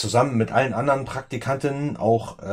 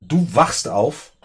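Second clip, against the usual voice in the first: ordinary talk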